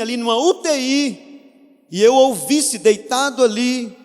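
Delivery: high, loud, slow preacher's cry; fervent preacher lifting his voice; preaching at full force. Acted. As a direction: rise in pitch and volume as the sermon peaks. Preacher building to climax.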